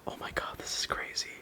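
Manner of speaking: hushed stunned whisper; awed whisper. Real, not acted. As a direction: whisper it in amazement. Awestruck at the new phone.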